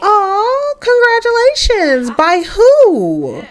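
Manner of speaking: high, drawn-out congratulations, then a question; high-pitched acted delight; gushing mom. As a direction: gush it way up high. Mom hears pregnancy news.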